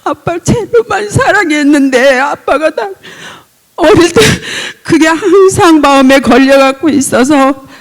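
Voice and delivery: high, loud, breaking voice pouring it out; tearful outpouring. Real, not acted. Daughter pleading with her father, high and tearful.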